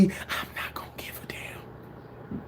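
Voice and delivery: slow breathy whisper; whispered, slow, imagining tears on TV. Pretend. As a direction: hush it like a secret to yourself. Imagines crying with trophy.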